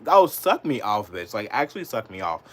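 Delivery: ordinary talk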